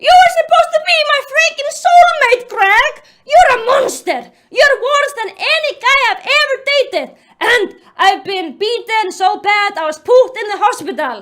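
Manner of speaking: high, loud, fast tearful tirade; hammed-up anguished yelling; yelled while sobbing. Jennifer accusing her soulmate Greg, tearful yelling. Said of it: "Jennifer yelled while sobbing".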